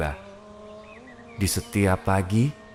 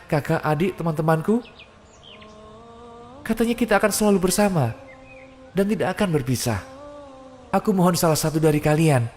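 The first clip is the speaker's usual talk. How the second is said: frantic begging cry; 8 semitones higher than usual. Acted. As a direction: call out to mother and family, rising in panic. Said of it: "Coba teriak yang keras".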